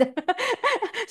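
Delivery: bright laugh bursting out high; high burst of laughter. Real, not acted. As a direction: break into a sudden high laugh. Laughs mid-story. (biglang mataas na tawa)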